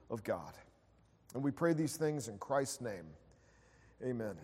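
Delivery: hushed, soft opening prayer. Real, not acted. Pastor praying quietly for the congregation before the sermon. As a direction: pray it gently and softly.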